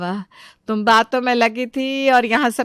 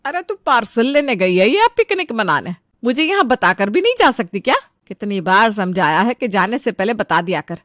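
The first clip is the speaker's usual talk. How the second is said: usual speaking voice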